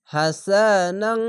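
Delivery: হাসানাং drawn out very slowly with humming nasal ং; nasal ending demo. Shown as a demonstration. Teacher showing students nasal ending of হাসানাং. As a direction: hold the nasal ং at the end of হাসানাং. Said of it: "ইকফা গুন্না বাংলা অনেস্কার মতো হবে".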